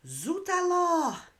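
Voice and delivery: a startled French waiter crying out; exclamation d'un serveur français, très aiguë et forte; French waiter's cry. Acted. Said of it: "cried the waiter".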